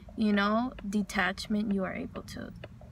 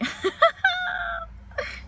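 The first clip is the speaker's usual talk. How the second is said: high burst of laughter; real, high-pitched laugh breaking out. Real, not acted. Speaker to audience after quoting an insult, high laughing.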